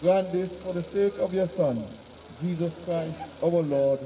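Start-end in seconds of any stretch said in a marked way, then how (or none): none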